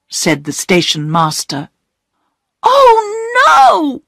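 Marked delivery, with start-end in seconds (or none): 2.6-4.0 put-on very high, loud child's cry of dismay; alarmed cry. A narrator's reading. Hidden child calling from the darkness, alarmed cry. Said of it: "cried a voice from the darkness".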